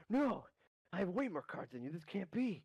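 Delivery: high shocked cry that drops into disbelief; shocked outburst. Acted. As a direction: yelp at losing, then refuse to believe it. NPC losing the card game.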